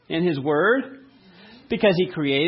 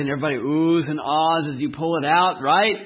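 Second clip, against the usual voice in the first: ordinary talk, nothing marked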